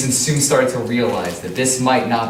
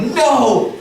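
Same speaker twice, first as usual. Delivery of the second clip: anguished cry; 11 semitones higher, 3 dB louder than usual. Acted. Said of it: "Jameson was like".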